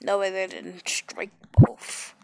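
usual speaking voice

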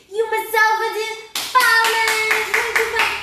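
high, loud cheer for applause. Real, not acted. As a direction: celebrate like a party host. Cheering after song. (aplauso entusiasmado)

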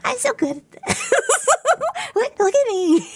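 real laughter breaking into the words; delighted laugh while bragging. Real, not acted. Laughing at own score.

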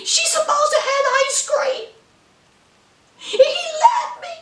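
hammed-up sobbing complaint; mock-distraught high whine; mock crying wail. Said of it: "she says".

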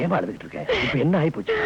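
low, soft and consoling; gentle concern. Acted. Grandfather to crying granddaughter, gently concerned. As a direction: ask tenderly, dropping low and soft.

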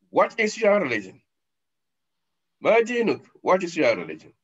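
high, loud demanding question; stern interrogation. Acted. Angels questioning the dead man, high and demanding. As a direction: grill him like a judge.

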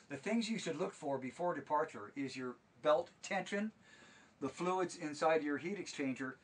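ordinary talk, nothing marked

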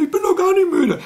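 very high whining puppet voice, "nicht" pushed hard; squeaky creature insisting she isn't tired; Elfriede's squeaky voice. Acted. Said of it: "Das ist bei Elfriede genauso".

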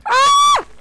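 high terrified shriek; shrill scream. Real, not acted. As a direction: scream in sheer terror. Sudden scare.

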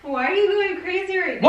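high, loud yell back; loud protest. Pretend. Prankster challenging boyfriend's outburst, loud protest.

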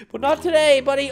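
normal talking voice

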